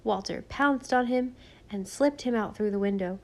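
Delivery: plain reading voice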